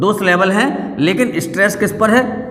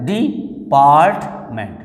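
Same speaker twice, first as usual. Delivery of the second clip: DEPARTMENT slow and lower, in three pieces; broken into syllables; 4 semitones lower, much slower than usual. Shown as a demonstration. Teacher counting syllables for students, word broken apart. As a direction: pause between DE, PART and MENT. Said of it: "तीन syllable हो गए".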